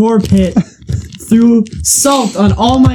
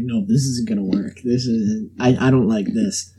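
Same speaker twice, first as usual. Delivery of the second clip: quiet panic; 9 semitones lower, 8 dB quieter than usual. Retold or imitated. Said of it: "And I'm like".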